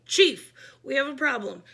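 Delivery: loud call into a walkie-talkie; shouting. A narrator's reading. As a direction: shout the alarm to the chief. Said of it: "she shouted".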